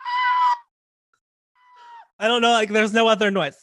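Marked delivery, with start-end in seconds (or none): 0.0-0.6 sudden high excited squeal; a tiny starstruck shriek. Retold or imitated. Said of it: "And I was like".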